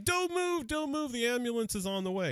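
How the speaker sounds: put-on very high, worried young woman's voice; high, breathless girl checking on a crash victim; panicked girl. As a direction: squeak it out like a frightened bystander. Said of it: "She's freaking out".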